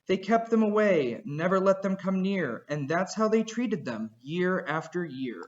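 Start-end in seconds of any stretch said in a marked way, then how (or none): none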